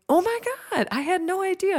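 high, surprised gasp of a reply; retold surprise. Friend's shocked reaction, retold.

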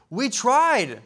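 high, loud mock-aggrieved complaint; indignant protest. Disciples blaming Jesus for leaving, mock-indignant protest. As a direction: protest loudly, hammed-up and hurt.

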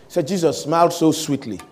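ordinary talk

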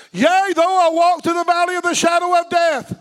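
loud, high dramatic verse; proclaimed scripture. Acted. Preacher proclaiming the dark valley verse, loud. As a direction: proclaim it with dread.

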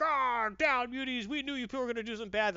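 hammed-up storming soldier; mock soldier bark. Reviewer mocking soldiers bursting through the windows, yelling. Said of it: "the windows, like".

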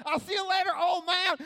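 put-on high, cheeky kid's yell; taunting shout. Child running away from the old man, taunting. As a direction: call it back as you flee.

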